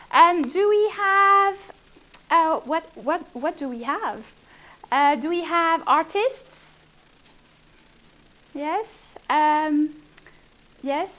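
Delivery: ordinary delivery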